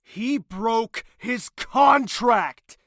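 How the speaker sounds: loud, slow and cutting; heated, slow snap; angry retort. Acted. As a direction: bite each word out. Villain defends himself, heated.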